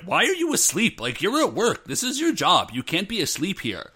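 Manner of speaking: lightly voiced boss chewing out a sleepy worker; irritated boss telling off his employee; mock scolding boss.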